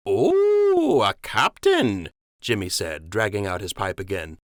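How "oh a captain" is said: high swooping Oh, then a slow drawn-out a captain; a rustic docker, faking being impressed; mock-impressed docker. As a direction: tease the captain's rank. Jimmy mocks the rank.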